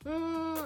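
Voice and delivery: high hummed "mmm"; drawn-out mock smooch hum; imitated kissing noise. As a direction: mimic a long pressed-kiss hum. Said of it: "like, making the noise".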